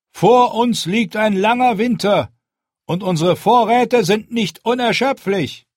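high, loud and firm, a leader addressing a crowd; Jon's commanding speech. Acted. Jon rallies the hungry wildlings, firm and loud. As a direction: speak up to be heard by the whole camp.

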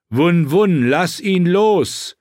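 high, loud, drawn-out yell; shouted command. A narrator's reading. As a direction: yell to make him let go. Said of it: "schrie John". (lauter, gedehnter Befehlsschrei)

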